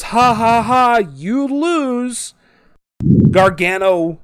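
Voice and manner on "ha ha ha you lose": high, put-on spoken laugh and taunt; mock gloating. Imitating Gargano revealing his cup, gloating. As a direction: taunt in a raised, sing-song pitch. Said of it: "Gargano pulls off a cup and goes".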